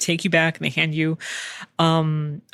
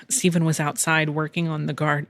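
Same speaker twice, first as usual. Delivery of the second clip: ordinary delivery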